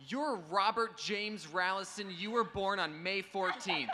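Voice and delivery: put-on awestruck kid. Little kid at a convention reciting facts, slow and high. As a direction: recite it like a starstruck little fan. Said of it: "and he goes".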